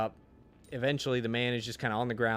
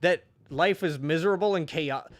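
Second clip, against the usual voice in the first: exasperated rant; 6 semitones higher, 6 dB louder than usual. Retold or imitated. Reader ranting about the pointless book, exasperated. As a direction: vent the frustration. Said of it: "And I was like".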